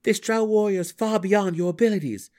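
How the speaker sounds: high, loud warning; urgent plea. A narrator's reading. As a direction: press the danger home. Drow pleading across cavern.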